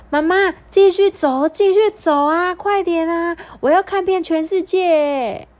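put-on high, urgent pleading child voice; little kangaroo. A narrator's reading. Little kangaroo begging tired mother to keep walking, high and eager. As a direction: pitch it high like a child urging mum on. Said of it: "这时候小袋鼠说".

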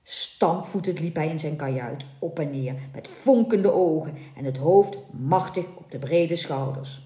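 usual speaking voice

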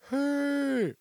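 put-on very high, loud drunken yell; drunk shout. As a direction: bellow it across the room while pointing. Drunk boss yelling from stage.

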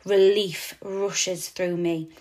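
plain reading voice

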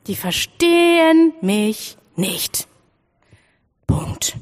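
slow, drawn-out and deflated; resigned thought. Acted. A child alone in class thinks it, slow and resigned. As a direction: let it sink, very slow.

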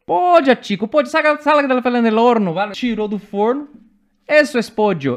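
espanhol alto e agudo, enfático; sogra chilena exclamando. Retold or imitated. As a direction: explique em espanhol, como sogra exasperada. Sogra chilena explica, impaciente, o pollo. (exclamação em espanhol chileno, alta e aguda)

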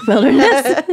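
word squeezed out through laughter; laughing. Real, not acted. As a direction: say it while cracking up. Repeating word while laughing.

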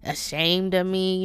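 ordinary talk, nothing marked

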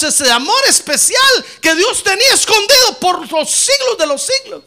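building to a loud, high shout; preaching at full force. Real, not acted. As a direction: rise to a fervent climax.

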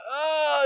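high, loud imitated freak-out wail; hammed-up "Ah" of alarm; mock panicked cry. Imitating mom freaking out over the news, mock panic. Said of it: "freaking out".

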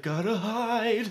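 high, drawn-out comic quote; high-pitched mock panic; mock panicked hider. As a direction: stretch it out high, like a silly camper scrambling away. Imitates camper hiding in recap.

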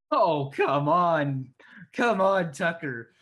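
slow, loud exasperation at a bad roll; frustrated groan. Real, not acted.

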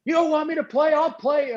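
high-pitched defiant challenge; retold angry yelling. Acting out the argument with the coach over playing, defiant mock yell. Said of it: "I'm yelling at him".

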